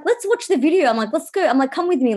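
faux-friendly, knowing mom tone; sly eagerness. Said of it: "So I'm like".

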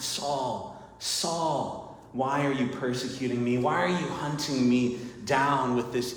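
soft, quiet intimate plea; tender pleading. Acted. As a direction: plead gently, like someone who knows him. Jesus pleading with Saul.

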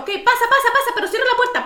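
high, loud, hurried doctor calling pasa; mock the cranky old doctor hurrying her in; impatient old doctor. Cranky doctor calling the patient in, high and loud.

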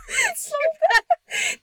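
high, loud laughing wail; real laughing fit; laughing hard. Real, not acted. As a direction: crack up so hard it squeaks. Laughing fit after story.

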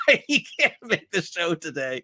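amused, breaking into laughter; laughing. Real, not acted. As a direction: crack up while teasing an absent friend. Teasing absent co-host, laughing.

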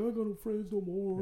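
high-pitched mock-tearful wail; retold weepy complaint; imitated crying. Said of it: "And he's crying".

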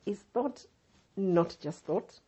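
ordinary talk, nothing marked

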